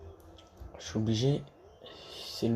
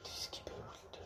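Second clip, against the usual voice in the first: whisper; 18 dB quieter than usual. Real, not acted. Thinking aloud, half-voiced.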